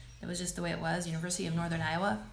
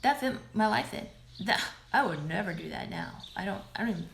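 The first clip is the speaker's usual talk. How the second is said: normal talking voice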